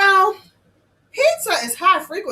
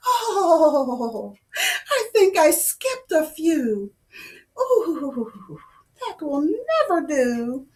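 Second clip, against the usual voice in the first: ordinary delivery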